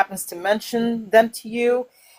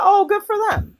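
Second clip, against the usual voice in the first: breezy; 11 semitones higher, 4 dB louder than usual. Retold or imitated. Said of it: "eventually somebody will mention them to you".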